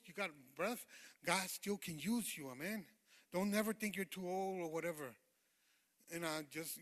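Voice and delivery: normal talking voice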